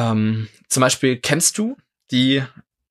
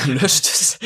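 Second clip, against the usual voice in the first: laughing; 5 semitones higher than usual. Real, not acted. Laughing while echoing a joke.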